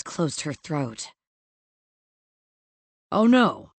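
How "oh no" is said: loud, higher incredulous challenge. A narrator's reading. Boss challenging Evie's refusal, incredulous. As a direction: throw it back in disbelief, louder. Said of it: "he asked incredulously".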